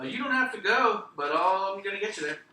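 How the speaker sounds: high, loud playful threat; acted insistence.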